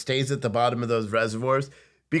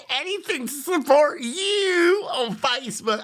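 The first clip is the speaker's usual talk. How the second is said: mock Southern accent; 13 semitones higher, slower than usual. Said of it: "I bet you have an accent too".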